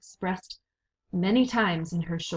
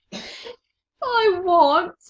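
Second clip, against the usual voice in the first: high, loud sobbing wail; tearful high wail; 12 semitones higher, 8 dB louder than usual. A narrator's reading. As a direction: cry the words out. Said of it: "sobbed Meg".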